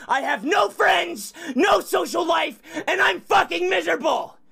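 furious, fast-building shouted rant; real rage. Real, not acted. Streamer answering chat's "fake rage" taunt, real furious rant. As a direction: rant at full tilt, genuinely miserable and enraged. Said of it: "This is me being fucking real".

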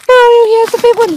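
fast, high and frantic; panicked high cry. Acted. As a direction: blurt the warning in panic. Warning in snowball fight.

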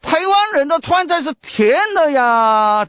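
mock a Sichuan tourist protesting the sweet food; loud Sichuan dialect. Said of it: "这个用四川话很大声讲啊". (用四川话大声抱怨)

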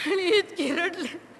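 slow, voice thick with tears; tearful, slow. Acted. She recalls how hard she cried, tearful. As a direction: let the tears catch the words.

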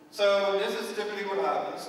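ordinary talk

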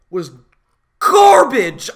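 a wail of real disgust; pained outcry. Real, not acted. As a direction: groan it like it hurts. Ranting about the second half.

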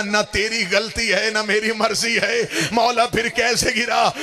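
high, urgent, wailing sermon delivery; impassioned lament preaching. Acted. Majlis speaker narrating the horse and Hussain to mourners, impassioned lament.

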